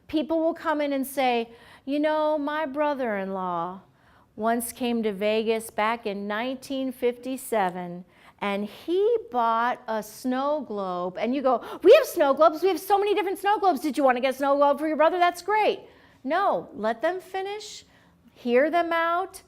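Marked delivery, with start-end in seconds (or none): 1.8-11.2 low, slow, rambling storyteller; unhurried customer reminiscing; slow talker. Acted. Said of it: "You'll also have talkers". 11.8-15.8 rapid-fire eager clerk; loud burst, then racing patter; overeager interrupting. Acted. Clerk cutting off a slow talker.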